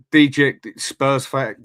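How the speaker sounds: slow, halting read of an odd username; slow careful reading. Real, not acted. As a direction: sound the name out slowly, unsure of it. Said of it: "I can't even read that properly".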